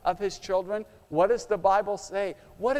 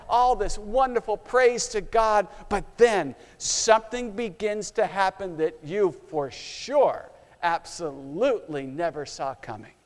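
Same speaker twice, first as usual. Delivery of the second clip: usual speaking voice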